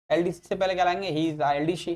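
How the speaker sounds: usual speaking voice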